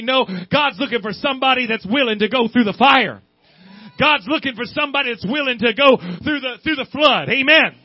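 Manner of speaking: loud, driving sermon delivery; fervent preaching. Acted. Preacher pressing the congregation, loud and driving.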